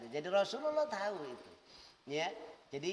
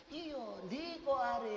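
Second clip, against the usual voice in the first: Rungkut village tune; 7 semitones higher, much slower than usual. Retold or imitated. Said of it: "Kalau ngomong ada, ada nadanya".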